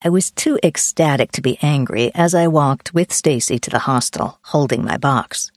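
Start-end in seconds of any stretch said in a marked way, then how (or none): none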